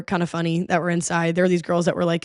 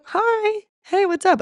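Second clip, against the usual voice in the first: friendly greeting; 12 semitones higher, slower than usual. Retold or imitated. Greeting a stranger.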